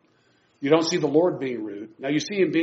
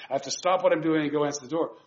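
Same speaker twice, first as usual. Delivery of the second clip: normal talking voice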